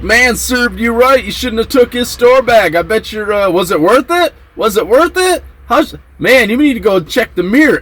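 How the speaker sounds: high-pitched gloating bunkie; high, teasing retold jeer; mock taunting. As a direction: imitate a cellmate needling the victim. Acted bunkie taunting the victim.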